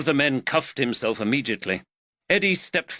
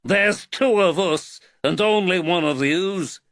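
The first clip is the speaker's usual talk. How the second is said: put-on Liverpool accent; 9 semitones higher, 4 dB louder than usual. A narrator's reading. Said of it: "the Liverpool accent".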